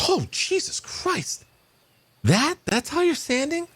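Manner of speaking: very high, drawn-out shocked exclamation. Acted. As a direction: squeal in appalled disbelief. Guy shocked at the sight.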